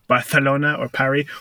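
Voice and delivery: "Barcelona" lisped, "Paris" with silent s; over-pronounced foreign names. Retold or imitated. Listing names people over-pronounce, "Barcelona" lisped, "Paris" French. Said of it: "you don't have an accent".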